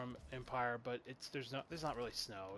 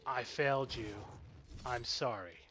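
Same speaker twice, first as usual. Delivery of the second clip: mock flat delivery; slower than usual. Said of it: "and this is how it sounds".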